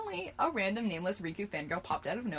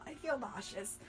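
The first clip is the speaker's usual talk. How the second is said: weak, soft, raised-pitch groan of words; sickly and much softer; croaked; 4 semitones higher, 9 dB quieter than usual. A narrator's reading. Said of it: "croaked Riku".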